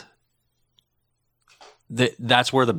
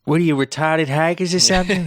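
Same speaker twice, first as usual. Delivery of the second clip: raised-pitch mock Maine accent; hammed-up Maine local; 6 semitones higher than usual. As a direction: put on a higher New England voice. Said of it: "have an accent from Maine".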